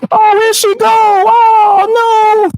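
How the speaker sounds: high, drawn-out fake-crying lament; mock wailing. Streamer laments opponent leaving the call, mock wailing. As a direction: wail in exaggerated, high mock dismay.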